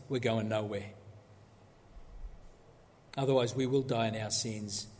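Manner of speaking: normal talking voice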